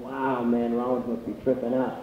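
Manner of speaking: low, quiet onlooker's murmur; hushed aside. Retold or imitated. Friends spotting his dad, low hushed wonder.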